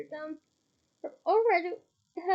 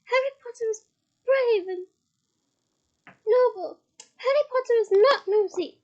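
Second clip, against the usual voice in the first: high, loud proud elf; a loyal elf shouting his hero's praise; high and loud proud squeak; 7 semitones higher, 10 dB louder than usual. A narrator's reading. Dobby defends Harry.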